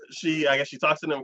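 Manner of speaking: usual speaking voice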